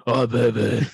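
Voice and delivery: mock superhero voice, put on; Batman impression. Friend questions the odd character choice, mock Batman voice. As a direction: exaggerate the silly vigilante voice. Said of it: "talk with a slightly deeper voice instead of".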